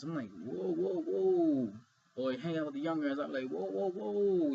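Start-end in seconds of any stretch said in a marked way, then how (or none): 0.4-1.7 slightly raised, swelling objection; mock alarm. Reacting to adults clubbing with young guys, mock alarm. 3.5-4.5 high, slow, drawn-out protest; mock alarm. Objecting to older adults acting twenty, mock alarm. Acted reaction to immature adults.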